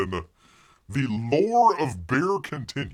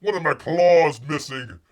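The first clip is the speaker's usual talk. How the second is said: loud, hammed-up bear voice; mock bear lament; 5 semitones higher, 6 dB louder than usual. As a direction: wail like a vain bear. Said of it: "He says".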